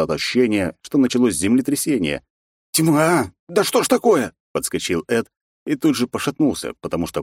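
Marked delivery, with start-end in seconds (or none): 2.7-4.3 jolted awake in shock; startled exclamation. Acted. Friend jolted awake by quake.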